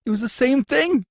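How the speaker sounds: high, loud, frightened gasp. A narrator's reading. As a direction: gasp it out in fear. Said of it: "gasped the prisoner".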